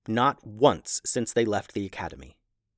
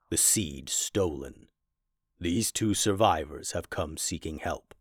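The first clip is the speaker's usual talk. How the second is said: grave professor voice; 5 semitones lower, 4 dB quieter than usual. Acted.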